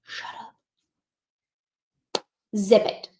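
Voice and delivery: breathy whispered shut up; soft conspiratorial whisper to the class; whispered aside. Real, not acted. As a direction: hush it right down to a whisper. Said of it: "And guess what I do then?".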